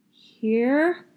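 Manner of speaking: high, loud questioning rise; boy unsure reply to the teacher; unsure answer. Acted. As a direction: answer like you're not sure it's right.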